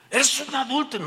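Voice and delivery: high-pitched mock denunciation; public accusation. Pastor accusing man beside his wife, denouncing. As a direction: hurl the charge at him.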